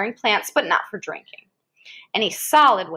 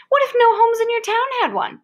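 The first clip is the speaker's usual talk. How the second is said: much higher and louder, a storyteller's wonder; dramatic question; 12 semitones higher, 4 dB louder than usual. Acted. Reading to a child, a big high dramatic question. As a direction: pitch it way up to hook the listener.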